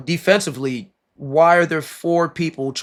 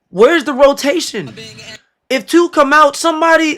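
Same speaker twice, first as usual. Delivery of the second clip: much higher and louder, fed up; getting mad; 10 semitones higher, 4 dB louder than usual. Real, not acted. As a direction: yell at the lazy defense. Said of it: "I'm getting mad at this defense".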